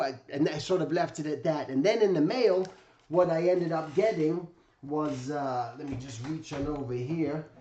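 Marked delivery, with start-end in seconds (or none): none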